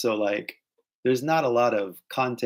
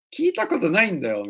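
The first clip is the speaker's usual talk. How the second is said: baffled; 8 semitones higher, faster than usual. Retold or imitated. Acts bafflement at unknown accent.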